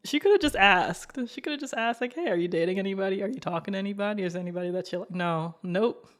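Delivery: normal talking voice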